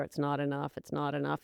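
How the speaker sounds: soft, low nagging murmur; whispering. Retold or imitated. Inner voice nagging in her ear, soft and low. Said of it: "whispering in your ear".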